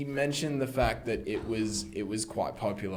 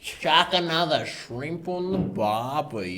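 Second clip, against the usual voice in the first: mock Aussie accent; 4 semitones higher, slower than usual. Mocking Australian stereotype.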